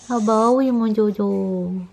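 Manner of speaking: slow, stretched cooing. Real, not acted. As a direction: draw out mon doudou tenderly.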